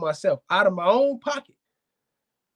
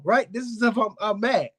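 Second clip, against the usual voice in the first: usual speaking voice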